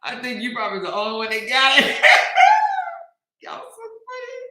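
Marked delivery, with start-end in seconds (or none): 2.0-3.0 short high shrieking laugh; high laugh burst. Real, not acted. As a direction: squeal with laughter.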